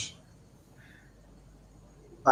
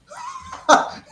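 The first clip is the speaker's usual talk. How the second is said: squealing high laugh. Real, not acted. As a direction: burst into a shrill laugh. Laughs at goal.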